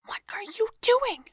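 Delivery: urgent whispered question. Acted. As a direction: breathe it so the gunman can't hear. Hushed question to a partner.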